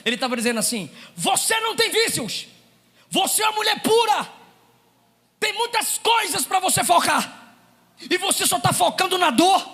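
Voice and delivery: loud, driving sermon delivery; full-force preaching. Acted. Preacher rousing the congregation, loud and driving. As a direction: preach it hard to the church.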